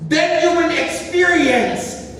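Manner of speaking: high, loud, very slow proclaiming; fervent slow verse reading. Real, not acted. Pastor proclaiming the verse's promise, slow and loud. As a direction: stretch every word of the verse.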